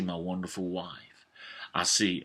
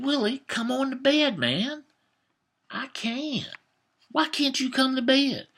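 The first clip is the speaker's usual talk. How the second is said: put-on kid voices. Boys pleading with brother in dark.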